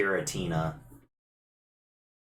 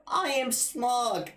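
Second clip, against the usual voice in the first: tiny villain voice. Acted. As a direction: squeak out grand threats like a tiny overlord.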